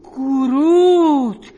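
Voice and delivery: slow, loud drawn-out name; the penny drops, stretch it out; stunned realisation. Acted.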